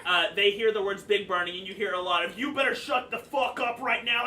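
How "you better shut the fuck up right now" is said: raised-voice heckle; angry crowd member. Acted. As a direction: snap back like an outraged onlooker. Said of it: "start to freak out".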